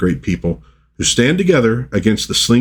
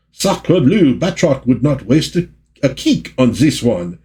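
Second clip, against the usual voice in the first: put-on French accent; 5 semitones higher than usual. Batroc speaks in comic panel.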